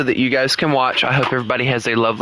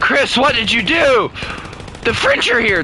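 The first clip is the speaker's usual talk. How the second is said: high-pitched playful panic; hammed-up dismay at a friend; mock alarm; 9 semitones higher than usual. Mock panic at French arriving.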